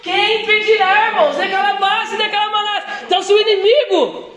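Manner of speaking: high, forceful preacher's exhortation; fervent preaching. Real, not acted. Preacher urging the congregation to act with God, fervent preaching. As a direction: build it up and drive each phrase home.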